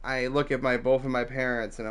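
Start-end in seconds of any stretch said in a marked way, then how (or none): none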